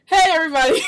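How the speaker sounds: loud, high-pitched holler; yelling greeting. Real, not acted. Host hollering hello to listeners, yelling. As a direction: yell hello way up into the mic. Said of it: "you yelling and stuff".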